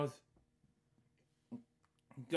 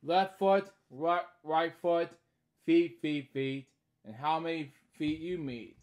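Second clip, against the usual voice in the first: lively sing-song read-aloud; storybook reading; 3 semitones higher, 5 dB louder than usual. Acted. Reading a bedtime story aloud, lively. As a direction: read each line brightly to a child.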